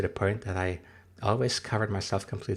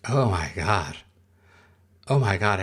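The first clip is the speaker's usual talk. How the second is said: louder, startled exclamation; real horrified reaction; 6 dB louder than usual. Real, not acted. Streamer reacting to the Hat Man image, horrified and loud. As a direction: blurt it out loudly at the scary sight.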